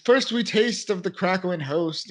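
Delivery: high-pitched ceremonial preacher's call; mock preacher. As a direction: announce a rite like a hammed-up preacher. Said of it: "the preacher at least".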